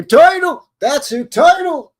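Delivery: high loud announcement of the name; excited name call. Real, not acted.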